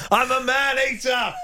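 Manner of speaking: high, held-out shouted lyric; mock shouting. Presenter joking about sleep-shouted lyrics, mock holler. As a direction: holler the pop line in jest. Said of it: "shouts out saucy pop lyrics".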